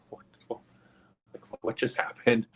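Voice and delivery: hushed, bewildered whispering; stunned whisper. Retold or imitated. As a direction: whisper it in dazed confusion. Stunned visitors left in the well.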